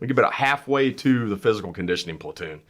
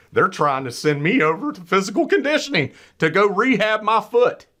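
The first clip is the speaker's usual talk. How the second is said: high, loud, exasperated telling; outraged they'd pull him out of training; indignant disbelief; 10 semitones higher, 3 dB louder than usual. Retold or imitated. Telling friends he nearly got dropped, indignant and higher. Outraged at being sent to rehab.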